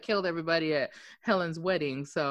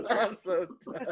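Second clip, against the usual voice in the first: laughing; 4 dB quieter than usual. Real, not acted. Giggling after the skin joke.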